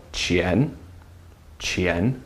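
"Qian" rising from low, softly; soft questioning upward "Qian"; rising second tone. Shown as a demonstration. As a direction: lift the pitch through each "Qian". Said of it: "Second tone though".

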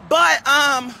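usual speaking voice

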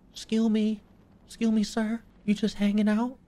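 high sing-out to a stranger; playful high-pitched call; mock-polite calling out. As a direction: politely hail someone in a high voice. Hailing the hanging body.

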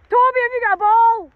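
high, loud call to the dog. Real, not acted. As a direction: shout across the field to Toby. Calling out to the dog.

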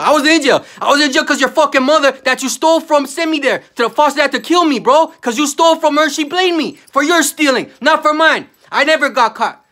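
loud, high outraged yelling; loud high furious yell about being blamed; furious shout. Real, not acted. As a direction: shout it out in real fury.

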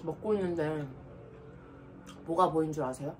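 words with food in mouth; slightly muffled chewing speech; talking while chewing. Real, not acted. Seen 1: Cheeks bulge and lips purse between words; food held earlier.

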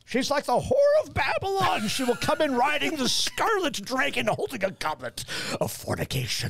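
high-pitched, hammed-up fire-and-brimstone proclamation, dropping at the end; mock doomsday preacher. Believer warning lecturer of Babylon, hammed-up preacher voice. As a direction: proclaim it like an over-the-top prophet.

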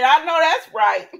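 laughing agreement; laughing along with the soap recap. Real, not acted. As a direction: laugh through the words.

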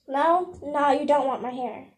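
ordinary delivery

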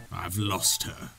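low, slow and soft menacing villain growl; Ganon voice. Acted. Streamer voicing Ganon over game dialogue, low slow villain growl. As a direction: drop deep and drag it out like the evil king. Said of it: "That's my Ganon voice".

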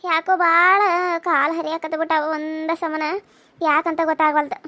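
weak and ailing. Acted. Mother-in-law pleading weakly with the guest for water. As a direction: ask for water as if parched and sick.

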